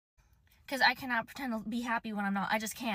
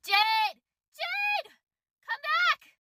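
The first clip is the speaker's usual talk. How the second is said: loud, much higher calls of a name, climbing each time; repeated yells, last one very high; yelling a name; 10 semitones higher, 8 dB louder, much slower than usual. Retold or imitated. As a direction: shout her name across the yard. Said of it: "He starts yelling my name".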